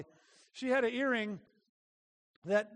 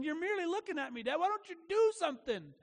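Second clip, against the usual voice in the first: put-on higher, fast, desperate child's plea; pleading child; 7 semitones higher than usual. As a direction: beg dad to do something, pitched up. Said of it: "she's looking at me going".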